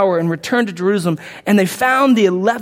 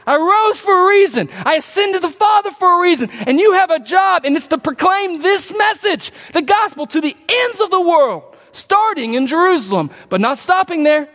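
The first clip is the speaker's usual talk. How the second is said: preaching Jesus' charge; 7 semitones higher than usual. Acted. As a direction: command the disciples to go to the ends of the world. Preacher voicing Jesus' commission.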